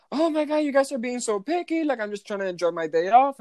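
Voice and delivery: high-pitched whiny complaint; mock whiny guest. Imitating a guest whining to the clerk about being picky, high and whiny. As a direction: whine like a spoiled guest caught out.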